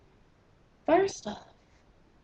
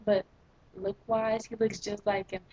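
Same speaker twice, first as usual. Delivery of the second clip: ordinary talk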